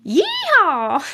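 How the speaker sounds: a loud, rising cowboy yeehaw; playful cowboy yell; cowboy holler. Acted. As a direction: whoop it like a rodeo cowboy. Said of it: "I need some more cowboy phrases".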